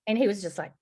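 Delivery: ordinary talk, nothing marked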